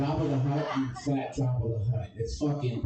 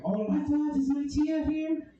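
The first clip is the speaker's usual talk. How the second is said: much higher, slowed squeal; mock excited friend. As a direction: gush it high like a thrilled partygoer. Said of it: "he's like".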